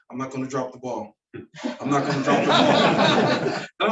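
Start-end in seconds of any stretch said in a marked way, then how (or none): 1.8-3.6 repeated higher and slower through a laugh. Retold or imitated. Coach repeating Bo's promise, laughing. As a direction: laugh while saying it. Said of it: "He's like".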